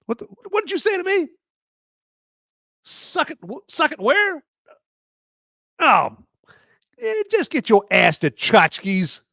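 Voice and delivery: indignant. Pretend. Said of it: "pretend exchange with the Bobs".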